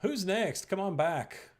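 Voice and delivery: usual speaking voice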